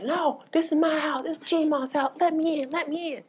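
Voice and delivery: very high screech without much volume; mock screaming. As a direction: imitate a shrieked protest, held back. Retells cousin screaming to get in.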